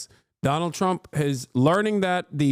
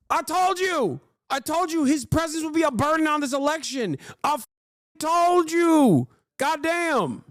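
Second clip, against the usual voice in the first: gloating I told you; 10 semitones higher than usual. Real, not acted. Gloating about predicting it.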